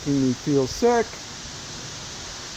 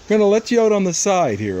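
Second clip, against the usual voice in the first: normal talking voice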